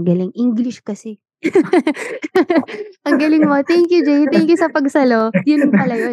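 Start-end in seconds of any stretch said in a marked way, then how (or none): 1.4-2.8 bursting happy laugh, pitched up; high delighted laugh. Real, not acted. Guest laughing at her own explanation, delighted laugh. 3.1-6.1 high, bright thrilled praise; delighted. Real, not acted. Guest thrilled the host found the word, excited.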